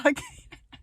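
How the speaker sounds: rising burst of laughter; high laugh. Real, not acted. To viewers, giggling at mama. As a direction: let the giggle rise high.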